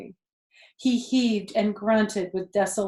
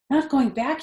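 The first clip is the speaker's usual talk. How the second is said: sudden high cry of disbelief; cried out; 6 semitones higher, 5 dB louder than usual. A narrator's reading. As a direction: leap up and cry out in alarm. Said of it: "he cried".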